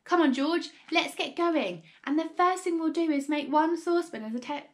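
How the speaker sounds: high, loud, eager urging; rally him to get moving; bursting with impatient enthusiasm; excited cry. A narrator's reading. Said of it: "cried Mister Cranky, leaping up".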